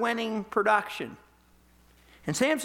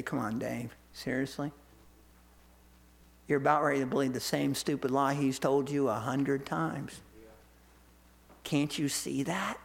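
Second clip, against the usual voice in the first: lower, softer, weary heavenly voice; angels scolding gently; 7 semitones lower, 3 dB quieter than usual. Retold or imitated. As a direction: sigh it down from heaven at Dave. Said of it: "the angels in heaven look down at me and say".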